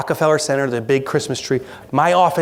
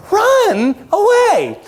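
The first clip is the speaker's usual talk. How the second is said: slow, drawn-out mock panic squeal; very high pitch; 4 dB louder, much slower than usual. Frightened side of the brain says flee, very high pitch. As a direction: stretch it out high in comic terror. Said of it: "at a very high pitch".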